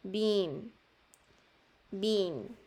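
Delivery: "Been" with a long "ee"; long-vowel demo. Shown as a demonstration. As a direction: model "been" holding the long I sound. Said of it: "es un sonido largo". ("been" con un sonido largo de i)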